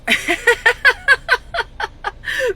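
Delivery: high burst of laughter. Real, not acted. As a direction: laugh out at the absurdity. Laughing at inadequate staff.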